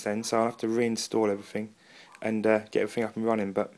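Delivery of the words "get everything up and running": ordinary delivery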